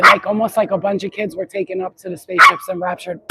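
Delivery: ordinary talk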